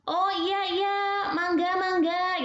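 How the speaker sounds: imitating a polite Sundanese speaker with a lilting melody; Sundanese cengkok lilt. Lecturer imitating Sundanese speech for students, light sing-song lilt. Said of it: "agak cengkok, ada cengkokan-cengkokan".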